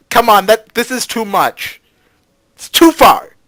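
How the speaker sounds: high and loud, climbing to a squawk; exasperated protest. Pretend. Player protesting that it goes too far, exasperated. Mock protest, getting higher and louder.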